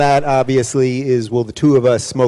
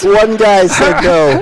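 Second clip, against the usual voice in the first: laughing through the words, slowed and higher; 6 semitones higher, 7 dB louder than usual. Real, not acted. Host laughing at the crowd's answer, laughing. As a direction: chuckle it out as you say it. Joking about the audience answer.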